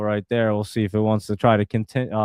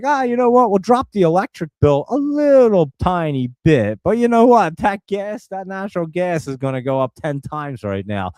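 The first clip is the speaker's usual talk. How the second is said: higher, louder smug company voice; mocking utility company; 6 semitones higher, 4 dB louder than usual. Streamer mocking the power company announcing price hikes, smug and higher.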